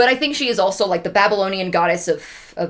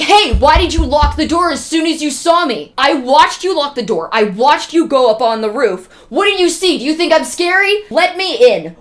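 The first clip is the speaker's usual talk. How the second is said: loud, higher angry demands at a door; mock-furious pounding-on-the-door shout; retold yell; 5 semitones higher, 5 dB louder than usual. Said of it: "and yells".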